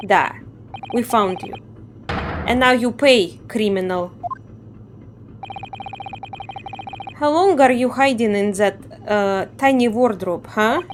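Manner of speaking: put-on a guard scolding in a light Russian accent. Guard scolds the sleeping prisoner, light Russian accent. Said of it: "I assume he's Russian".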